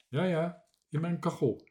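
usual speaking voice

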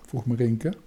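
usual speaking voice

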